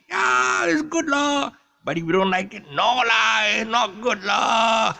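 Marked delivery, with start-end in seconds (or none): 0.1-1.5 loud, high Singlish yes with lah. Shown as a demonstration. Host demoing local style, loud Singlish lah. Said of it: "you speak the local style lah". 2.7-4.9 loud, high, slow Singlish no with lah. Shown as a demonstration. Host demoing local style, loud slow Singlish lah. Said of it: "you speak the local style lah".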